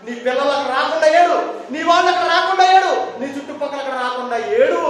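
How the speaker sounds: high, loud exhortation; forceful preaching. Acted. Jesus rebuking the mourners, forceful preaching. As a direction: drive each command at the congregation.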